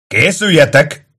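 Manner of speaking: slow, drawn-out high shout; shouted order. A narrator's reading. Kato to his men at the gate, shouting. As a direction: stretch out a loud command. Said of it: "kiáltotta".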